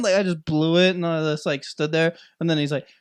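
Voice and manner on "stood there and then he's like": usual speaking voice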